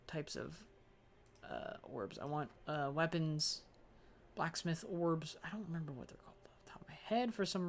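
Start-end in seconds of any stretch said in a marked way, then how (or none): none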